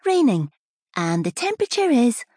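chirpy cabin-crew singsong, pitched high; sing-song announcer voice. Acted. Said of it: "The flight attendant had one of those sing-song voices".

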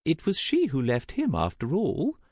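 high, gently regretful; sighing. A narrator's reading. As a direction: say it on a mild sigh. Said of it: "she sighed".

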